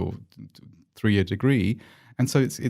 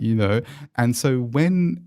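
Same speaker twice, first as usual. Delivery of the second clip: usual speaking voice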